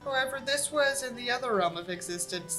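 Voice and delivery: usual speaking voice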